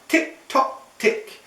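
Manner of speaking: crisp whispered tick tock; ticking clock. Acted. Matty listening to the oven clock, whispered ticks.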